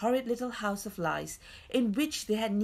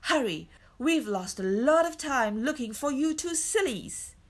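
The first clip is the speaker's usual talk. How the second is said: higher-pitched scolding urgency; impatient; 6 semitones higher, 4 dB louder than usual. A narrator's reading. Man with no patience for tears, impatient. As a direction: chivvy them along crossly. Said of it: "who had no patience with tears".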